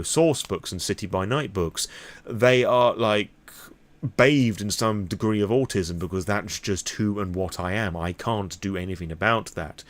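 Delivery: usual speaking voice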